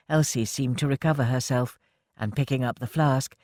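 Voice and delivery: ordinary delivery, nothing marked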